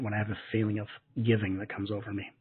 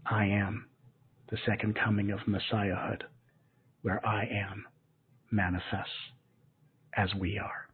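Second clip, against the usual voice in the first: spiritual invocation. Acted. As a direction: intone it like a sacred proclamation.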